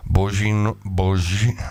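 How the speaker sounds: normal talking voice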